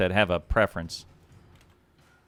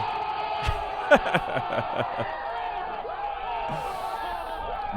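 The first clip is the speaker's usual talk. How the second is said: wheezy laugh; 4 dB quieter than usual. Real, not acted. Laugh after proclamation.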